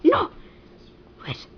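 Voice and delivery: normal talking voice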